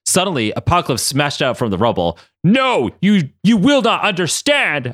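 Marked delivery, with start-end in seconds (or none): 2.4-4.9 cried out. Acted. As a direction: cry it out in protest. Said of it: "Apocalypse cried out".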